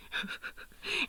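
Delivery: soft, high chuckle; short breathy laugh to oneself. Real, not acted. Laughs after quoting friend's threat.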